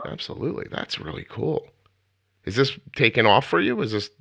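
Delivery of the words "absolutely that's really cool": breathy murmured approval; soft, voiceless aside of admiration; whispered. Real, not acted. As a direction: say it under your breath, unvoiced.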